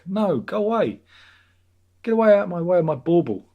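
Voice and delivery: angry at a pop-up; shooing pop-up. Real, not acted. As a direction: yell at the pop-up to get lost.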